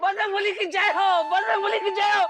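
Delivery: high-pitched frantic chant to the god; startled, high devotional shouting; panicked invocation. Acted. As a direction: cry out the praise in a panic. Panicked praying on waking in temple.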